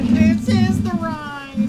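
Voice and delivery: ride announcer. Acted. Slow high announcement over music.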